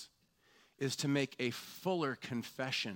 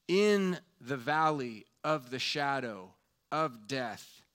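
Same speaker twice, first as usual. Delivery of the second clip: louder, slower, rising force; building preaching; 2 semitones higher, 5 dB louder, slower than usual. Real, not acted. Preacher naming grief to the congregation, building force. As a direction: lean into each phrase of the list.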